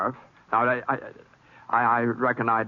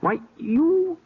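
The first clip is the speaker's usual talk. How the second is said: much higher, sputtering; stunned outrage; slower than usual. Acted. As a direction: choke on the shock. Stunned by accusation.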